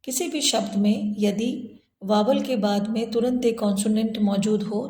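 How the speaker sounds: ordinary talk, nothing marked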